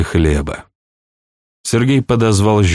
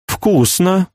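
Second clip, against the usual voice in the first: princess voice; 11 semitones higher than usual. A narrator's reading. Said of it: "заметила Флоранс".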